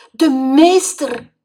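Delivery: slow, high, loud dismayed cry; horrified gasp at the teacher's fate; shocked. Acted. Boy shocked, frog lost.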